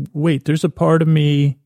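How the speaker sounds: ordinary talk, nothing marked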